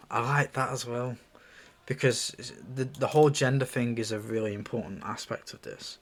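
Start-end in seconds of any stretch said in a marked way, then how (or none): none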